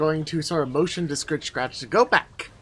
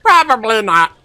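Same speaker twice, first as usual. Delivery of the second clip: goblin voice; 6 semitones higher, 11 dB louder than usual. Acted. Mange's voice in scene.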